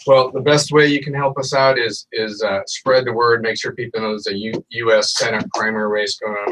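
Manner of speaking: normal talking voice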